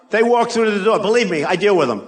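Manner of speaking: normal talking voice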